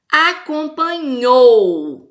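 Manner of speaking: "Acompanhou" drawn out syllable by syllable, last syllable "nhou" long and loud; stressed last syllable. Shown as a demonstration. Teacher showing class the tonic syllable, "nhou" stressed in "Acompanhou". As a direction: say "Acompanhou" very slowly, leaning hard on final "nhou". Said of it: "pronuncia com mais intensidade".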